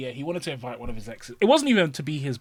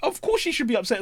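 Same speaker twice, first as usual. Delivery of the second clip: much higher, louder exasperated rant; incredulous outburst; 14 semitones higher, 7 dB louder than usual. Retold or imitated. Ranting at a friend about his worried wife, high and loud. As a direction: rant at your mate. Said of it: "I was like".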